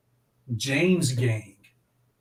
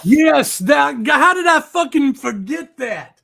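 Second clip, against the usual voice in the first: excited shout; 12 semitones higher, 8 dB louder, slower than usual. Real, not acted. Bandmate names the forgotten opener.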